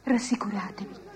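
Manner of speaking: soft whisper to comfort; whispered reassurance. Acted. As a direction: hush them gently. Fairy calms the king and queen.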